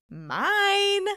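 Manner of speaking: high, loud gleeful cheer; exultant victory cry; triumphant. Real, not acted. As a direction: crow about the win. Triumphant reveal of winning team.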